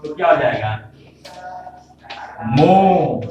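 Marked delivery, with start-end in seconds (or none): 2.5-3.2 मो said alone and held, a little louder; मो sung out as the answer; model pronunciation. Shown as a demonstration. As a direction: sound out मो for students. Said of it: "अब इसको पढ़ेंगे मो".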